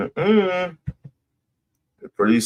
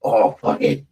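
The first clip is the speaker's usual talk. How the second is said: mock giving-up voice; 10 semitones lower than usual. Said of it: "If a motherfucker goes".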